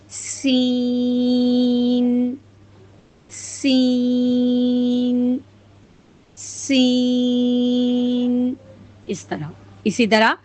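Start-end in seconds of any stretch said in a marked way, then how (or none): 0.1-8.5 سین held very long with a whistling s; hissing seen demo. Shown as a demonstration. Teacher drills the letter for students, slow and whistling. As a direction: draw out سین slowly, whistle first. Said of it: "پھر سین ادا کریں گے".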